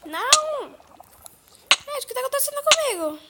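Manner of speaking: high-pitched panicked outcry; panicked yell. Real, not acted. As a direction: cry out in alarm. Sudden game disaster, yelling.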